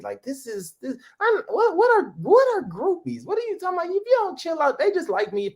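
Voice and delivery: incredulous. Retold or imitated. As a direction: play the clueless preacher's kid, baffled. Acting his naive younger self.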